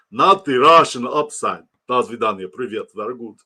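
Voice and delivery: put-on Russian accent, starting loud and easing off; hammed-up Russian accent. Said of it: "I'm done with my Russian accent".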